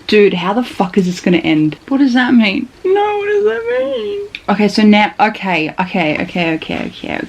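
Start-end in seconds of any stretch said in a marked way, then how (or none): 2.8-4.3 very high, thin wail of confusion; distressed whine. Real, not acted. Reader pleading with the book for answers, high distressed whine.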